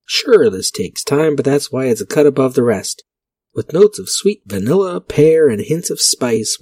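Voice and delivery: ad read. Acted. Vlogger reads an old whiskey ad aloud in announcer voice. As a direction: sell it like a fifties radio ad. Reads Wild Turkey label.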